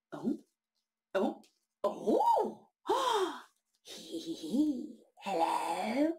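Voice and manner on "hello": ordinary delivery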